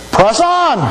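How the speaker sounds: high, ringing preacher's cry to press on; rousing call. Real, not acted. Preacher urging the church to keep running, rousing call. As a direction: lift the congregation with it.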